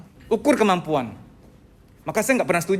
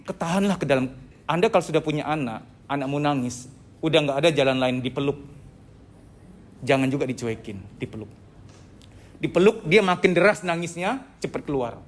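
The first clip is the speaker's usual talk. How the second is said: ordinary talk, nothing marked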